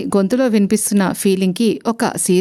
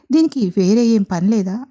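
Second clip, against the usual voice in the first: ordinary delivery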